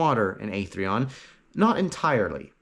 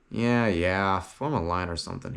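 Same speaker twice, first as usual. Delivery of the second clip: grumbling; 5 semitones lower than usual. A narrator's reading. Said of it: "he grumbled".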